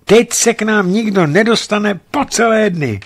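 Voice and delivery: high-pitched delighted exclamation; exclaiming. A narrator's reading. Woman delights at being snowed in with him, exclaiming.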